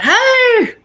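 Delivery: high, loud, drawn-out holler; man calling out. Retold or imitated. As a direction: call out eagerly to get Kathy's attention. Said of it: "There was, like, a man like".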